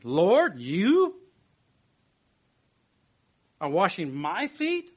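slow, incredulous question rising on feet; surprised Peter. Retold or imitated. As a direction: stretch it out in disbelief. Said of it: "Peter's surprised question".

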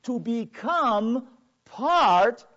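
slow, loud, high proclamation; preacher building to a climax; preaching at full force. Real, not acted. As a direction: stretch every word to the Elohim.

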